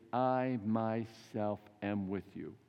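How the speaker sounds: slow, measured words of reassurance; drawn-out promise from Jesus; slow solemn promise. Retold or imitated. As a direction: let each word land slowly.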